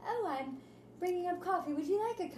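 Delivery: a hint of a proper little gentleman offering coffee; polite little boy. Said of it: "He's like".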